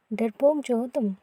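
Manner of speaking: ordinary talk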